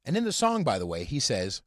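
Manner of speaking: ordinary delivery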